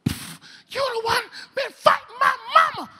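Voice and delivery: slow, very high angry snarl. Retold or imitated. Inmate punching the glass, slow high snarl. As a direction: snarl the accusation slowly and high. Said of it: "he starts snarling".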